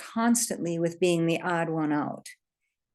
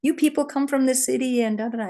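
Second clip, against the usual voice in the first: much higher, heated mock rant; imitating an irate bow hunter, pitched up; angry; 6 semitones higher, faster than usual. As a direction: rattle off the hunter's grievance fast and high. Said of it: "I am angry".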